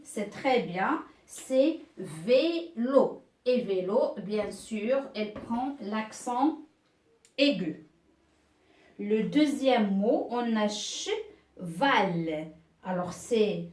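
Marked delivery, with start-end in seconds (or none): 1.2-3.2 C'est vélo said very slowly, drawn out; slow confirming. Shown as a demonstration. Teacher confirming a pupil's answer, slowly. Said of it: "teacher confirms answer slowly". 10.7-12.5 on a cheval drawn out very slowly; slow reading. Shown as a demonstration. Teacher reading the next word slowly. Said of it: "teacher reads word slowly".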